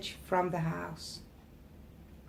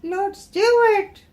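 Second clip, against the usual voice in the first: high, loud cry for help; panicked yell; 12 semitones higher, 8 dB louder than usual. Acted. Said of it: "wanted to yell in panic".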